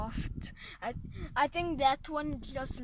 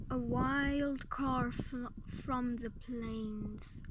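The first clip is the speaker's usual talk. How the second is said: nature documentary; 7 dB quieter, slower than usual. Pretend. Mock nature documentary narration.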